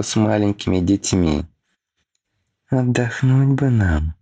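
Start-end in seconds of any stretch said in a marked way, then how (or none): 2.7-4.1 higher, pointed hint. A narrator's reading. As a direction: drop a hint with a sigh. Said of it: "не без намёка посетовала Фелиса".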